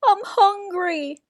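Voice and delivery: high pleading cry. A narrator's reading. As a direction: cry out your hunger. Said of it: "cried Cyril".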